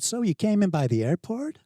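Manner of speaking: put-on high, loud San Diego teen, rising question; San Diego accent. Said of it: "do a San Diego accent".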